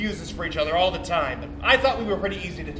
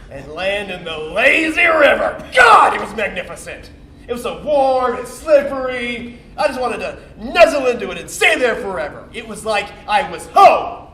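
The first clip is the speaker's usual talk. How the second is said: loud, rapturous rush of feeling; ecstatic reverie; 4 semitones higher, 9 dB louder than usual. Acted. Blissfully recalling the lazy river.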